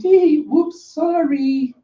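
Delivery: very high, sing-out sorry; silly apology. Acted. Bao Hong apologising to Kaido, silly and very high. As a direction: squeak out a playful high apology.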